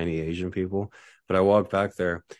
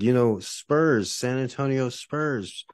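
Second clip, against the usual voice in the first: slow, higher, over-clear Spurs; slow emphatic explaining; 7 semitones higher, slower than usual. Retold or imitated. Telling the Micronesians about the Spurs, slow and emphatic. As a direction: spell it out slowly like to a child. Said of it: "So I'm like".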